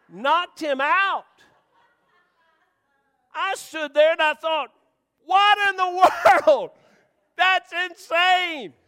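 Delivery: loud, high, slow amazement; preacher thundering over the knockout; amazed. Real, not acted. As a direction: proclaim it like a miracle. Telling the stunning knockout.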